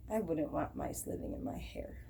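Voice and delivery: a hushed confiding whisper; whispered aside. A narrator's reading. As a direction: whisper it to the listeners. Narrator's aside to listeners.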